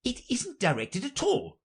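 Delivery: very high, deferential voice; high-pitched plea to the king. Acted. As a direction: interrupt politely as an anxious courtier rabbit.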